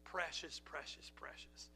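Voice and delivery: barely audible precious; tender whisper. Real, not acted. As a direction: whisper it, deeply moved. Moved by the story.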